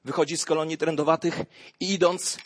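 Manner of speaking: ordinary talk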